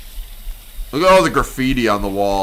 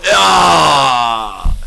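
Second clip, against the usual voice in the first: loud disgusted ah; 3 semitones higher, 6 dB louder than usual. Real, not acted. Disgust at the question.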